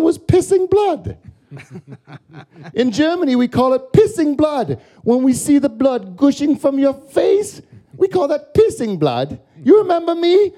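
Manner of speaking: high, thin German tourist voice; German accent. Retold or imitated. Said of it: "a German guy came up to me and he says".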